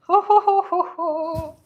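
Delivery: gleeful ho ho laugh. Pretend. Gleeful mock laugh at the logo.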